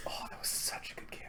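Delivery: soft nostalgic whisper. Real, not acted. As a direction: breathe it out fondly.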